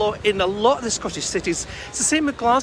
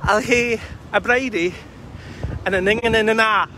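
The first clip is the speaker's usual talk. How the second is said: put-on Dundonian accent; 3 semitones higher than usual. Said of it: "forgive my Dundonian accent".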